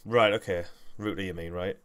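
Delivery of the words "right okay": normal talking voice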